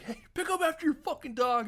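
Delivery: high-pitched irate yell, imitated; indignant call-out. Passer-by scolding a dog owner, indignant and high.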